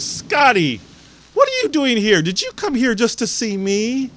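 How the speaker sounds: high, loud surprised squeal of a question, acted; delighted shock. Hospital patient sees young visitor walk in, delighted shock. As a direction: imitate her astonished greeting, pitch leaping up. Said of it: "she is shocked to see Scott".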